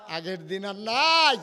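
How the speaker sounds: loud cry swelling on the last word; defiant cry. Acted. Umar declaring the old days over, defiant cry. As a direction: launch নাই upward into a long shout. Same quote repeated as a cry.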